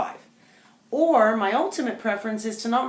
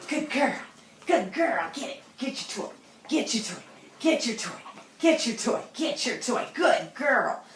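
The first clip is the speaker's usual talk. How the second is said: excited tug encouragement; 4 semitones higher than usual. Real, not acted. Tug game with dog.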